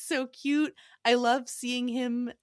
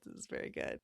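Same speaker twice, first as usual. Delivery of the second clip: whispered; 14 dB quieter, faster than usual. Real, not acted. Quiet aside after praising phrase.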